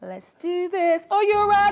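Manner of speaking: slow hype-up, then a high loud call; hype woman. Acted. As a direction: pump up the crowd like a hype woman. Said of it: "I sounded like one hype woman".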